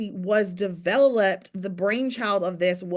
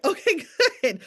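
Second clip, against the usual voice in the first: high, bright-sounding mock relief; high relieved laugh-voice; relieved okay; 8 semitones higher, 4 dB louder than usual. Relieved reply retold.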